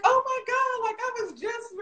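high, thrilled gasp of disbelief; excited squeal. Retold or imitated. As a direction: squeal it out, can't believe the luck. Thrilled reaction to Boston trip.

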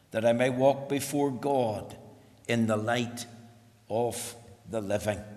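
scripture reading. Acted. Pastor reading the psalm to the congregation, soft and solemn.

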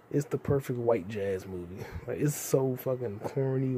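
ordinary talk

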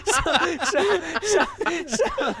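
laughter pushing through words; high laughing. Real, not acted. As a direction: giggle out the words. Cracking up at own story.

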